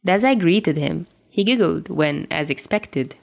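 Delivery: plain reading voice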